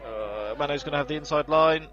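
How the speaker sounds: rising excited race call; motorsport commentator. Acted. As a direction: build the tension of the move.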